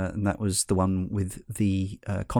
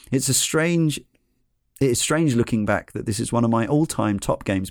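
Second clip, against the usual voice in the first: ordinary delivery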